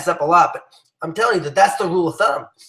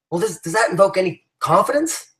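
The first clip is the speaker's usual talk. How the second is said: ordinary talk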